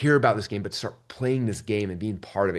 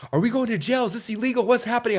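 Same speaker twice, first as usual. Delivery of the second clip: imitating his wife's panic, much higher; mock panic; 11 semitones higher than usual. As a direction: play her frantic worry lightly. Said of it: "she's like freaking out".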